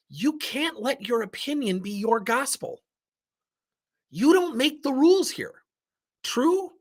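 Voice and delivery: high-pitched, firm reprimand; forceful. Retold or imitated. Manager setting a tough employee straight, forceful. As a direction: lay down the law, pitch pushed up. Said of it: "I did that very forcefully with her".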